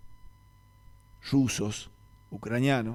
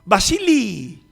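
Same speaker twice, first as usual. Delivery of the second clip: much higher, louder call of the name; 10 semitones higher, 11 dB louder, much slower than usual. Retold or imitated. The boss finally calls the employee by name, loud and high. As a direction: call him down from the office.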